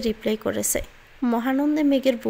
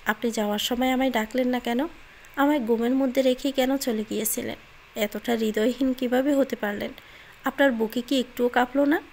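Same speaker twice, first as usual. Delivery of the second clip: ordinary delivery, nothing marked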